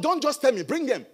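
ordinary delivery

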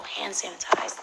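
ordinary delivery

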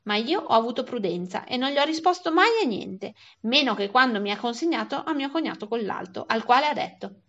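plain reading voice

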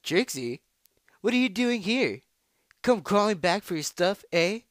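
loud, high, taunting challenger; full-voiced jeer at a beaten rival; brash rival voice. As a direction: call out a mocking greeting, high and forceful. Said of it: "try that one more time".

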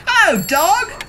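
high, slow and bright hello from Duck; cheerful duck greeting. A narrator's reading. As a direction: sing out a friendly hello as Duck.

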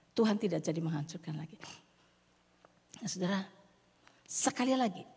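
ordinary talk, nothing marked

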